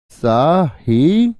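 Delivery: Sahi drawn out very slowly; teacher stretching Sahi so students notice the split; stretched syllable. Shown as a demonstration. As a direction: hold Sahi long so the class hears it. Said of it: "देख लो ध्यान से".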